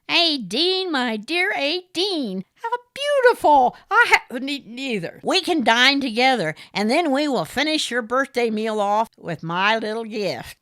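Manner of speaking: high, loud smarmy gentleman voice; fawning over Aideen, the smarmy caller; Morris, oily suitor. A narrator's reading. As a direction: purr it like a pushy suitor.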